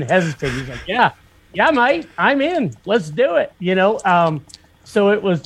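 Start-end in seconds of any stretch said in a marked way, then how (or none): 0.9-3.5 lightly voiced eager mate, pitched up high; Nick Trask impression. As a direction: jump in with a keen, high "yeah mate, I'm in". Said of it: "that was my best Nick Trask impression".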